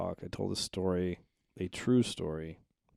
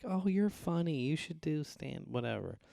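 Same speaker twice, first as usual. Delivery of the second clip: high teacher voice; 9 semitones higher than usual. Retold or imitated. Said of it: "she was like".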